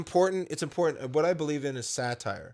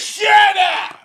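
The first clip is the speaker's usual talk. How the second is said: much higher, louder sudden scream; Adam Sandler yell; 14 dB louder than usual. Retold or imitated. Second Adam Sandler, sudden loud man-child yell. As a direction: explode like an angry man-child. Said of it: "And then there's".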